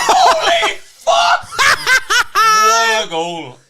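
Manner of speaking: high, drawn-out real scream; slow roar of amazement at a goal; ecstatic screaming. Real, not acted. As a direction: stretch it out at full volume. Celebrating a goal.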